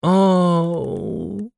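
high, stretched-out cooing aww; drawn-out cooing aww. Acted. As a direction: coo it long and high like at a puppy. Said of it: "протянула Дольче".

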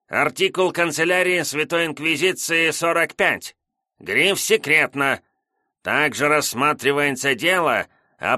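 high nasal, nagging clerk reading. A narrator's reading. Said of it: "противным гнусавым голосом".

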